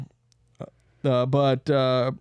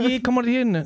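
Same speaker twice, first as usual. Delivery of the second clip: higher-pitched British bruv caricature; mock bruv accent; 9 semitones higher than usual. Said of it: "bruv accent".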